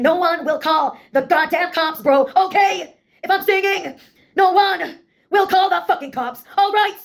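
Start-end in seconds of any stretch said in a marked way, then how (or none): none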